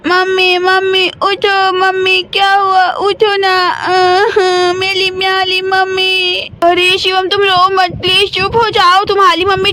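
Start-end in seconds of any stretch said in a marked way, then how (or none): 0.1-6.4 put-on sobbing little boy pleading with his mother; crying child. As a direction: cry and beg mummy to wake up, slowly. Child cries over fallen mother. (रोते हुए बच्चे की आवाज़)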